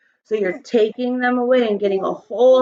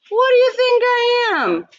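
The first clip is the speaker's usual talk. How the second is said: tooth fairy; 13 semitones higher, 4 dB louder than usual. Acted. Tooth fairy voiced in story.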